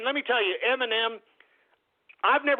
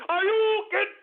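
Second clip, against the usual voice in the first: video game shout; 11 semitones higher than usual. Pretend. Host answers the caller with a shouted Hadouken. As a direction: yell it like a Street Fighter move. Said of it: "Can I do a Hadouken?".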